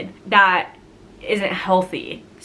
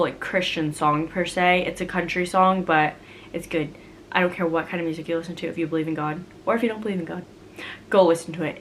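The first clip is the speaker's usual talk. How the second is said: ordinary delivery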